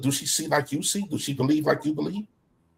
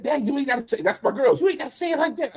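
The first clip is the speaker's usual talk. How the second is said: higher son voice complaining, lightly done; imitating the son; 10 semitones higher than usual. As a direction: mimic the son's gentle protest, pitched up. Son voiced in retold talk.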